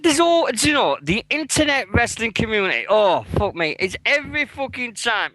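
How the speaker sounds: loud, high, fed-up outburst; exasperated rant. Real, not acted. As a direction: vent your frustration loudly. Ranting about wrestling community.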